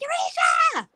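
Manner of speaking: put-on high, loud child's yell; mock toddler shriek; imitating toddler scream. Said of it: "she likes to scream".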